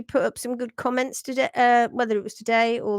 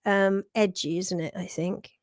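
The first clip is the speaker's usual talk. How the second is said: usual speaking voice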